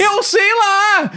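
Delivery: high, long drawn-out cry; high-pitched exasperated protest; exasperated shout. Real, not acted.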